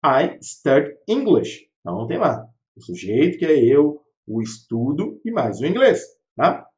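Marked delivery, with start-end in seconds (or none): 0.0-1.7 slow model sentence. Shown as a demonstration. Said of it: "você poderia falar".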